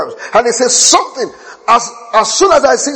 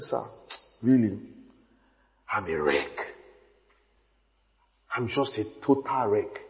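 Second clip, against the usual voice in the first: defeated; 12 semitones lower, 15 dB quieter than usual. Retold or imitated. Defeated man confessing to preacher.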